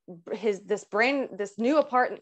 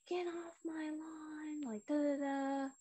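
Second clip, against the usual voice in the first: hushed, high mock yell; retold mad neighbor; 7 semitones higher, 14 dB quieter than usual. Neighbor going off on a girl for parking, hushed mock anger. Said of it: "He is mad".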